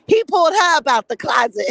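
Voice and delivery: gleeful shout. Real, not acted. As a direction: shout it out laughing. Shouting punchline.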